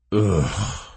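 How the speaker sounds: low disgusted growl; deep growl. A narrator's reading. Verimo disgusted by Sword's speech, growling. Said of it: "growled Verimo in a deep voice".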